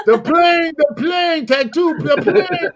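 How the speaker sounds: very high-pitched excited mimic; catchphrase impression. Joking off the guest's word, high catchphrase impression. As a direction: call it out high, dragging out the last words.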